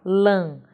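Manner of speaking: "lã" with a nasal a; nasal vowel. Shown as a demonstration. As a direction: let the vowel of "lã" resonate through the nose. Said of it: "ela tem um som nasal". ("lã" com som nasal)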